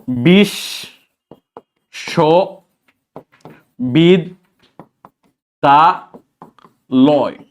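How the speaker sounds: বিশ্ববিদ্যালয় broken into five very slow, separate syllables with long gaps. Shown as a demonstration. Teacher to students, splitting বিশ্ববিদ্যালয় into slow syllables. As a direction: pull বিশ্ববিদ্যালয় apart one syllable at a time. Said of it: "বিশ্ববিদ্যালয় উচ্চারণ করে নিজে নিজেই".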